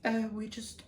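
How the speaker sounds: high-pitched mock-British voice; poor British accent. Imitating British garden-show couples, poor British accent. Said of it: "the British people are like".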